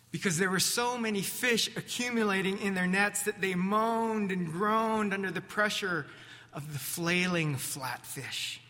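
ordinary talk, nothing marked

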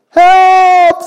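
high, loud cry for help; frightened disciples shouting in the storm; shouted. Acted. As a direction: yell for help from a sinking boat. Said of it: "they shouted".

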